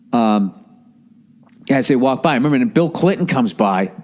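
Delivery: ordinary talk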